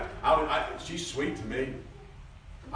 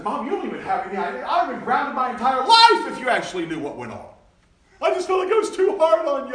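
much higher, louder insistent plea; rising protest; 12 dB louder than usual. Retold or imitated. Preacher retells telling his mother she had no idea. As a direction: press the point harder. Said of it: "I said".

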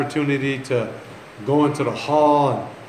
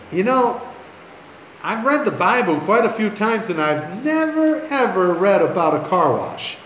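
retold emphatic objection; 5 semitones higher than usual. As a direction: push back with exaggerated disbelief. Pastor rebuffs car wash idea.

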